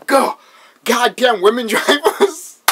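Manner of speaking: loud, high imitation of a furious passenger; hammed-up exasperated yelling; mock angry yell. Chucky cursing at the driver, mock angry yell.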